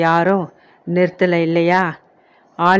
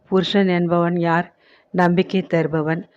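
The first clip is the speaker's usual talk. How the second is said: plain reading voice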